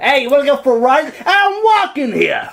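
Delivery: high, loud hammed-up New Yorker; New York accent. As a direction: bark it like a pushy New York cabbie. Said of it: "that's more like New York".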